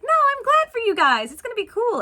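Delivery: high, loud mock cheerfulness; chirpy reassurance. Cindy assuring the stepsisters she is glad, chirpy. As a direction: pitch it way up, eager to convince.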